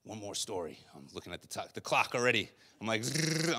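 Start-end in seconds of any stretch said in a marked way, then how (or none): 3.0-3.6 machine gun noise. Shown as a demonstration. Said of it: "I'm gonna machine gun you guys".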